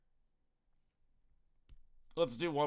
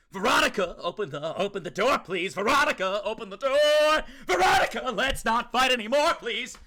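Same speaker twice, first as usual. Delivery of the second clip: loud, higher calls through a closed door; a hammed-up plea to a partner behind a door; mock pleading call; 6 semitones higher, 7 dB louder than usual. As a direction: beg her to open up, calling out.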